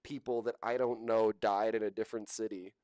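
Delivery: mimicking a fake-scared influencer; mock tearful whine. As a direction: parody her fear and tears. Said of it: "and she's like".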